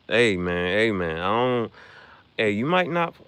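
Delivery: ordinary delivery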